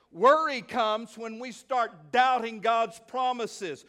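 preacher driving the point home to church; emphatic preaching. Acted. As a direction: hammer home the promises from the pulpit.

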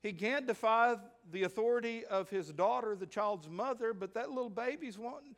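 loud, high, impassioned preaching; preaching at full force. Acted. Preacher dramatizing grandpa's dilemma.

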